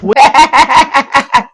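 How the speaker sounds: loud high burst of laughter; sudden loud cackle. Real, not acted. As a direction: laugh out at the absurd punchline. Reacts to TV punch line.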